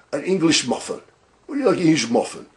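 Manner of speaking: put-on lightly done English accent of the gecko from the insurance ad; hint of a British accent, quoting the gecko commercial. Vlogger mimicking the English gecko in an English accent. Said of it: "was that a good, uh, accent there?".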